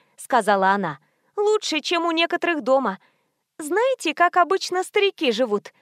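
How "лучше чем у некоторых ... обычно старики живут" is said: high-pitched, chatty nurse voice; boastful nurse. A narrator's reading. Nurse comparing cottage to old people's homes, boastful. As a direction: show it off with lively pride.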